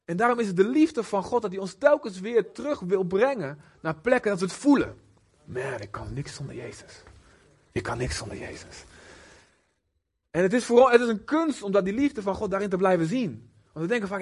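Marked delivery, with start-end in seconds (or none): 5.5-8.8 soft, hushed, low admission; quiet confession. Acted. A humbled believer confessing to God, hushed. Believer admits needing Jesus.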